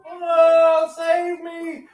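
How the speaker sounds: high playful plea; a high mock cry to be saved; fluttering cries for help.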